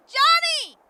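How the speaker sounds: loud, high call. Acted. Siobhan spotting Johnny and loudly calling to him. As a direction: hail him from a distance.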